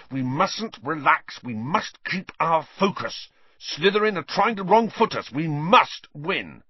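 high, intense, emphatic rallying; overwrought urging. A narrator's reading. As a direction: drive each command home frantically. Overwrought captain rallying his team.

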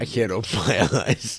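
laughing through the words, high; giggly stoner laugh; stoner giggle. Real, not acted. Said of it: "I'm so high right now".

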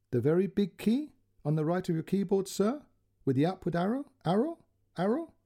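careful polite dictation of numbers; a hint of an Indian call centre voice; call centre girl. Call centre girl's quote.

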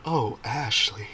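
slow, drawn-out mock-sultry delivery; imitated sensual murmur. Podcast characters teasing their host by name, imitated sensual murmur. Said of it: "like, sensually go".

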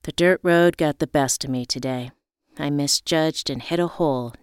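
plain reading voice

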